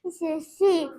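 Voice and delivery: said out loud and clear. Real, not acted. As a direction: speak up clearly for the teacher. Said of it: "Can you say louder".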